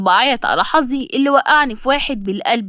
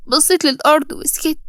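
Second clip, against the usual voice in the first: ordinary delivery, nothing marked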